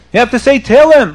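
stern order. Acted. Rabbi forbids the sinner blankets, stern order. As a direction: forbid it with indignant force.

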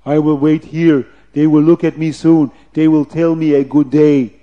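loud, stiffly measured officer; officer's put-on voice. Officer waiting for children to greet him, pompous put-on voice. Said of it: "maybe he talked like this".

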